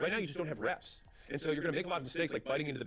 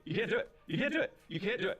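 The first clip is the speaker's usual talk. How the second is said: insistent; 8 semitones higher, 3 dB louder than usual. Real, not acted. Insisting, rising each time.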